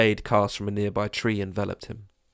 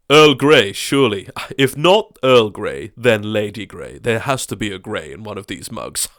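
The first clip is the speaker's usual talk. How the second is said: posh neighbour; 7 dB louder than usual. Acted. Crowd offering teas.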